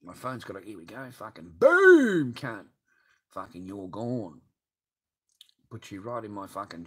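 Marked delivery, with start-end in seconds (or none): 1.1-2.3 long, high, loud drawn-out boom; hammed-up slow booming shout; mock explosion yell. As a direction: blast it out like a cartoon explosion.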